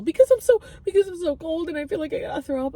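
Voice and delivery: high whiny complaint; high, teary explanation; whining. Retold or imitated. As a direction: whine like a sick child. Whining cause to the voice.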